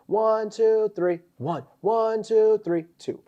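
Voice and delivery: light imitation of a drill count, high on two; sing-song the drill count; push-up count cadence. Imitating boot camp push-up counting, lightly chanted.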